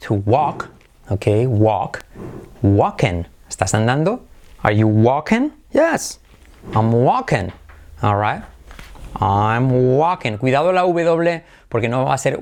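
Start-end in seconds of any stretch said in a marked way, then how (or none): 5.7-6.2 squeaky yes. Acted. Acted high answer.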